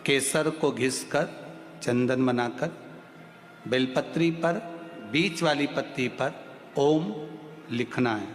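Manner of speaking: soft, slow, measured teaching; hushed, unhurried preacher; calm devotional instruction. Real, not acted. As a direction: give ritual instructions quietly, with pauses between phrases.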